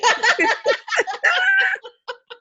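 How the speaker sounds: high shrieking laugh; loud laugh. Real, not acted. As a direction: burst out in a loud cackle. Shared laughter.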